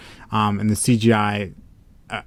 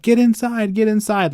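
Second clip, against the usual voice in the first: restrained high mock yell; mock panicked scream; 11 semitones higher than usual. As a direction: mimic people screaming for cover, held back. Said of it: "like, screaming and being like".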